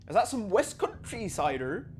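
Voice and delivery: mock West Country yokel asking about cider; a deliberately terrible West Country accent. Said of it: "That was a terrible accent".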